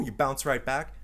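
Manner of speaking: ordinary delivery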